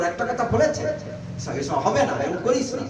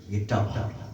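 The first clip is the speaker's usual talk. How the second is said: much lower and softer, soothing; calm, gentle; 9 semitones lower, 3 dB quieter, slower than usual. Retold or imitated. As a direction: settle the voice like a calmed teacher. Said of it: "শান্ত হয়ে বলছে".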